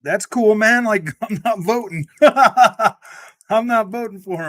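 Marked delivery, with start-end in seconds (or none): 0.0-2.0 mock agreement. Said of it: "And I'm like". 2.1-3.2 sudden loud burst of laughter. Real, not acted.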